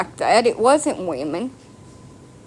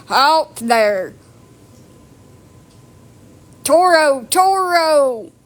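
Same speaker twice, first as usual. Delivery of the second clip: louder, higher playful bullfighter taunt; mock matador call; 4 semitones higher, 4 dB louder, much slower than usual. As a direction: call out the bull like a hammed-up matador.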